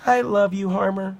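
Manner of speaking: tender storybook declaration; affectionate little alpaca. Acted. Alpaca hugs his former bully.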